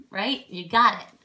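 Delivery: ordinary talk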